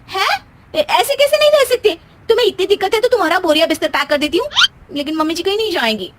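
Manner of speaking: high startled cry into fast protest; stunned, then rush to defend her; a startled high cry, then a quick defiant rant; shocked objection. Acted. Husband says they can't live together.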